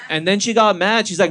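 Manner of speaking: ordinary talk, nothing marked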